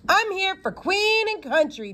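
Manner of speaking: mock leading-man bravado; mimicking Johnny Lott. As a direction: send up the actor with a grand, lofty line. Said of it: "mimicking him".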